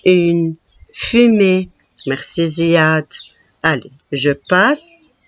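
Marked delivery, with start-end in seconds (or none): 0.1-1.7 slow whisper on une fumée. Shown as a demonstration. Teacher whispering the word to the class. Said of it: "Le mot fumée".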